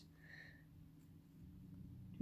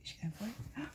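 tense, softer unvoiced whisper; 3 semitones higher, 10 dB quieter than usual. Real, not acted. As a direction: hold your breath and whisper. Whispering, watching the spider.

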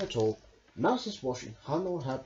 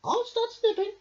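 high, chirpy little beep; 5 dB louder than usual. Acted. Rabbit ready to cut the cloth, high and beeping. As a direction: pipe up keen to start. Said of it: "beeped Rabbit".

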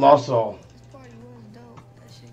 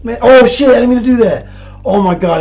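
panicked shout; 8 semitones higher, 10 dB louder than usual. Real, not acted. Panics after punching himself.

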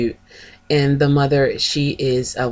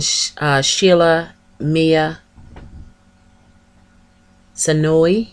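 ordinary talk, nothing marked

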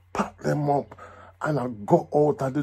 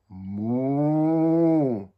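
cow moo; 2 semitones lower than usual. Pretend. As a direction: fake a cow, badly. Foreigner thief fakes an animal.

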